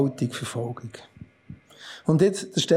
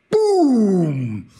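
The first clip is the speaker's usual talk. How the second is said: loud boom; 2 semitones higher, 4 dB louder than usual. Acted. Explosion of rage in the council.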